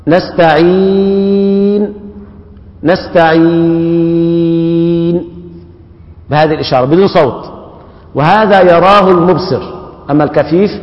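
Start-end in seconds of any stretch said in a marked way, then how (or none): 0.1-5.2 نستعين with the ي drawn out for many counts, slow; long held vowel. Shown as a demonstration. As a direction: hold the vowel of نستعين long, then stop silently. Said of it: "بحركتين أو أربع أو ست".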